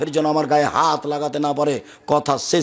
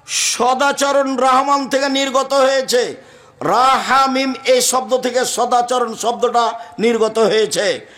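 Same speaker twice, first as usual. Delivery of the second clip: high, sustained hadith declamation; drawn-out, chant-like sermon delivery; impassioned preaching; 7 semitones higher, 3 dB louder than usual. Acted. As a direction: proclaim it on a high, ringing monotone. Preaches hadith at full force.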